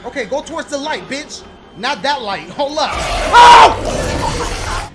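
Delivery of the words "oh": high, loud shriek; startled scream. Real, not acted. Player surprised near the light, startled scream. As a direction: scream in sudden fright. Jump scare in game.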